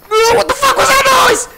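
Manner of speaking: high, loud frightened yell; scared yell. Real, not acted. Player spooked by a noise, frightened yell. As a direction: shout it out in fear.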